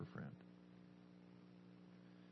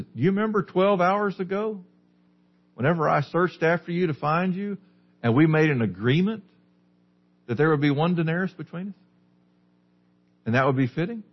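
higher-pitched, pointed questioning; raised questioning tune on each phrase; reasoning landowner; 8 semitones higher than usual. Acted. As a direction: remind them point by point, pitch lifted, as the landowner. Landowner reminds worker of agreement.

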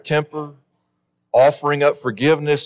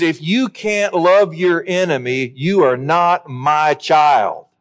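slow, emphatic voicing of God, slightly raised in pitch; God's stern pronouncement; 4 semitones higher, slower than usual. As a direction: lay it down word by word as a divine verdict. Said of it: "God says".

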